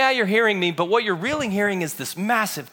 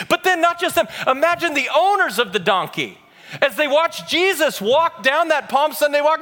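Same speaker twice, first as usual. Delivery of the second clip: raised, driving preacher's voice; impassioned preaching; 6 semitones higher, 4 dB louder than usual. Acted. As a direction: preach it with building fervor. Preaches at full force.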